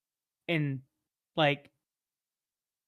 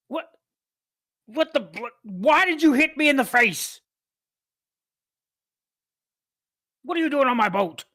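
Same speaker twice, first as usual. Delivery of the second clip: loud, high outraged demands, dropping off at the end; angry ship captain; 7 semitones higher, 6 dB louder than usual. Acted. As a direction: bellow at the stowaway who hit you. Captain confronts intruder.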